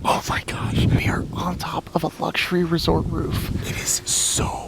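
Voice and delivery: hushed whisper on a rooftop. Real, not acted. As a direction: whisper so no one hears. Whispering on roof at night.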